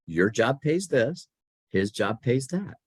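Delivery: slow, deliberate, each clause spaced out; slow explanation. Retold or imitated. Mentor explaining pay to young employee, slow and deliberate. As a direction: spell it out slowly. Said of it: "She said".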